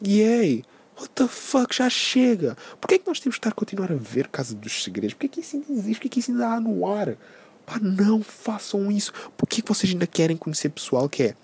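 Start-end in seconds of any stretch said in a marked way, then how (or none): 0.1-0.6 high, loud mock-celebratory yay; mock cheer. Podcaster greeting a reality show's return, mock cheer. 1.0-9.1 high-pitched exasperated rant. Real, not acted. Podcaster ranting about a reality show's return, exasperated.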